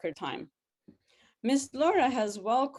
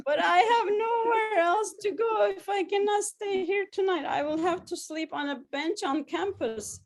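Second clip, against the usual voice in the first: distressed pleading; 10 semitones higher, 3 dB louder than usual. A narrator's reading. Happy balloon bursts, pleading.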